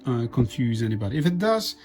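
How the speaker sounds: normal talking voice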